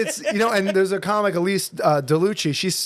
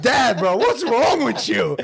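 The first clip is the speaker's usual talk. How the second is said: higher-pitched, exasperated protest at Dad; exasperated son; 6 semitones higher, 3 dB louder, slower than usual. Retold or imitated. Son calling out his dad's fake accent, exasperated. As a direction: plead with Dad, stretching it out.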